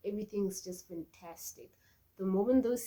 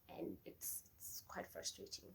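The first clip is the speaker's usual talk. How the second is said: real whisper, confiding; hushed, whispered confession; whispered aside; 18 dB quieter than usual. Real, not acted. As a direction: drop to a whisper to share the frustration. Confiding her frustration.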